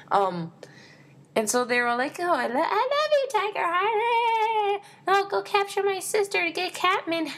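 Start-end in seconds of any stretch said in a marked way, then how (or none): 2.2-7.3 hammed-up squeaky falsetto, gushing love; very high mock lovesick whine, stretching the words; Dovewing. Fan mocking a lovestruck cat heroine, high squeaky voice. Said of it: "that's my Dovewing voice".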